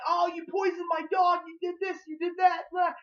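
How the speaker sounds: high-pitched, hammed-up accusing shout; mock accusing outburst. Reviewer voicing Citizen Z accusing the cosmonaut, hammed-up outrage.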